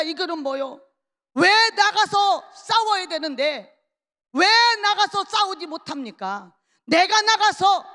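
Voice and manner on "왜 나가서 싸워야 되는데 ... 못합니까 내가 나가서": high, loud rallying protest; defiant challenge. Acted. David demanding why no one goes out to fight, loud defiance. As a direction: cry it out as a bold challenge.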